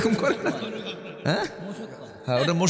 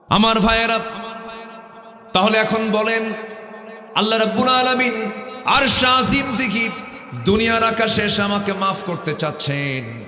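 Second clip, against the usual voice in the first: full-force preaching; 6 semitones higher, 4 dB louder than usual. Acted. Preacher voicing God's call to the congregation, fervent. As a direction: preach it out with fervour.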